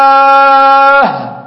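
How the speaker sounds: الصلاة chanted high and slow with its ending clear; correct ending. Shown as a demonstration. Teacher chanting the correct word to students, slow and high. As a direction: hold الصلاة long and clear. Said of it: "كده دي الصلاة".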